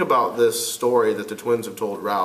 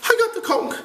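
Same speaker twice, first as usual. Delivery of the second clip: put-on much higher, nervous fat boy; timid boy voice; faster than usual. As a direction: plead timidly as Piggy. Piggy voiced.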